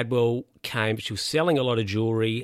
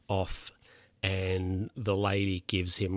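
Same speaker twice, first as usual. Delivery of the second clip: ordinary talk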